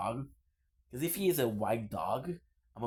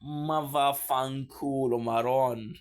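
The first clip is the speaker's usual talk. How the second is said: mock Italian cursing; 5 dB louder, slower than usual. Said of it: "So you tell them".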